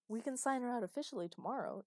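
high, loud firm demand; insistent arguing. A narrator's reading. Father argues to get his daughter out, insistent.

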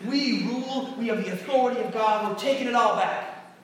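lightly hammed-up victory declaration; mock triumphant. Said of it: "the kind of triumphant".

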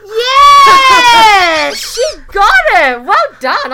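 loud delighted shout; excited cheer. Real, not acted. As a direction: cheer her on at full volume. Quiz partner gets it right.

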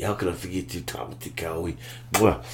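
ordinary talk, nothing marked

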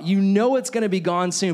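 ordinary talk

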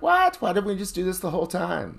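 high-pitched, exasperated "what?" easing slightly; mock incredulous outburst. Yoga student protesting to the instructor, mock incredulous. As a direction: retell it as a squeaky, hammed-up protest.

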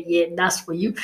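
ordinary delivery

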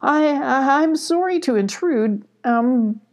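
high, hesitant apology; trying to be friendly. Acted. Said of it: "trying to be friendly".

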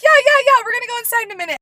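high, loud imitation of eager friends; excited brush-off. Friends putting off a bathroom request, high excited imitation. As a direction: rattle off the yeahs high and loud.

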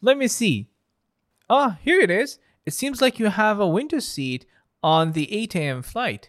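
bright, high airline clerk; friendly check-in agent greeting a passenger; customer service voice. Acted. Airport clerk role.

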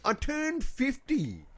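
slow, high mock wail over turning fifty; mock lament. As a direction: drag it out like a tragedy. Dramatic lament about turning fifty.

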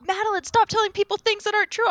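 high-pitched imitation of a scolding teacher; mock yelling. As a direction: scold a child in a retold teacher's yell. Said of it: "like, she yells at me".

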